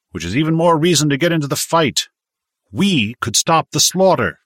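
lightly voiced man arguing, building in force; impassioned objector. A narrator's reading. Wojak tells of massacres in Poland, increasingly impassioned. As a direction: build toward an urgent plea to fight.

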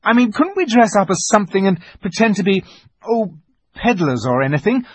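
put-on high, urgent boy grasping at plans; impassioned protest, pitched well up; agitated pleading. A narrator's reading. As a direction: argue desperately, stumbling for words. Peter argues desperately for a rescue.

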